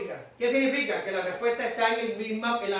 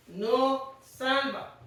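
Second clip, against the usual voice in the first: very slow emphasis; 5 dB quieter, much slower than usual. Real, not acted.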